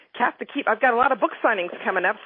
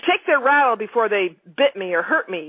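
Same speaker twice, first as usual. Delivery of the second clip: ordinary delivery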